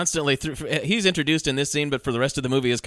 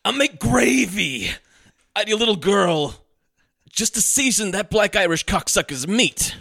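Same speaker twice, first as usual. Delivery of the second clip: slow, higher, relishing menace; salivating menace; 4 semitones higher than usual. Retold or imitated. Host quoting Walken threatening the patsy, slow relish. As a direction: savor every word of the threat, slowly. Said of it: "he's practically salivating".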